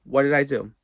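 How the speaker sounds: ordinary delivery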